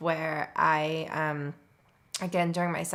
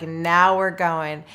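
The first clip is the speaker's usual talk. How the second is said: louder, slow, drawn-out eager call; excited midwife; 7 dB louder, slower than usual. Retold or imitated. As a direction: stretch it out with delight. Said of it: "And she was so excited".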